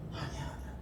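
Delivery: put-on 아니야 whispered softly, like hushing a child; hushed, comforting 아니야; soothing whisper. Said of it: "하나님은요".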